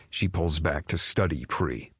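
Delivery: plain reading voice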